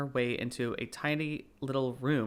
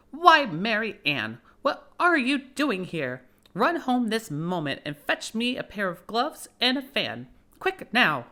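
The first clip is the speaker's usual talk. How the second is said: angry rabbit; 8 semitones higher, 7 dB louder than usual. Acted. Rabbit scolding Alice as his housemaid, high angry call. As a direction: bark orders at the housemaid. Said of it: "called out to her in an angry tone".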